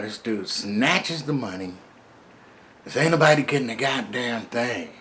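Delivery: normal talking voice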